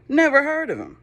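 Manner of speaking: high, loud dismissive Pharaoh; scoffing Pharaoh. Acted. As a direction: brush him off like an arrogant king.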